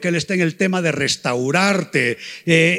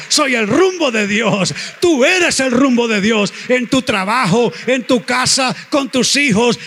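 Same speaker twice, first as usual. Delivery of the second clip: higher, louder, rousing sermon delivery; fervent preaching; 6 semitones higher, 4 dB louder than usual. Acted. Preacher rousing the church about God's direction, fervent. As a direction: lift the congregation with rising fervour.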